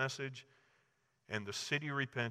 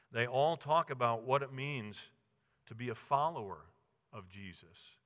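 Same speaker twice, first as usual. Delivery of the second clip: normal talking voice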